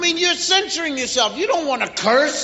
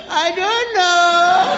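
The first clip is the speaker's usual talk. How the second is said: put-on higher, drawn-out child's whine; clueless child; 4 semitones higher, 4 dB louder, much slower than usual. Child to parent who asks why, clueless and drawn-out. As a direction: stretch it out slowly like a clueless kid. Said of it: "Well, that's brain damage".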